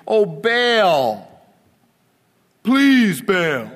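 loud, high, desperate calling out; pleading cry to Baal. Acted. Preacher acting Baal's prophets, crying out loud. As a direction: beg the idol to answer, voice cracking upward. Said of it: "They didn't say that. That's my ad lib".

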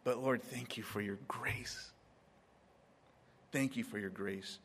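a hushed grateful prayer; grateful whisper. Retold or imitated. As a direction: breathe the thanks quietly. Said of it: "I tell God".

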